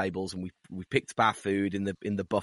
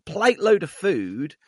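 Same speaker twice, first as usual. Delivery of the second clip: normal talking voice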